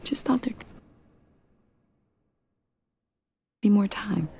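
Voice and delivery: whispered, breaking with grief; tearful whisper. Acted. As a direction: let the words fall away in sorrow. Grieving daughter.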